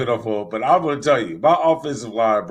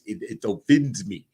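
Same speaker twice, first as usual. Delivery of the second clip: badly faked African accent from the Concussion film; Will Smith concussion accent. Shown as a demonstration. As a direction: attempt an African-accented movie impression. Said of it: "Will Smith concussion voice".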